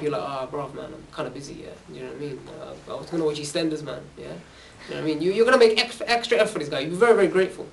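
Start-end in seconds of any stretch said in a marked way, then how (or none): 0.2-5.2 much softer, mumbled and lower, couldn't-care-less; mumbled mock excuse to a friend asking for help; lazy brush-off. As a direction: brush off a friend's call, barely bothering to speak.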